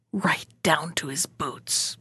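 slow, breathless whisper; hushed whisper. A narrator's reading. Narrator whispering slowly to spook the listener, hushed. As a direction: drop to a creepy whisper for the shiver.

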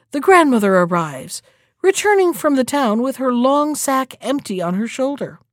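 ordinary delivery, nothing marked

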